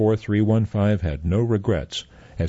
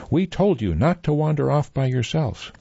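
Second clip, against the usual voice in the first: scolding mother; 8 semitones higher than usual. Acted.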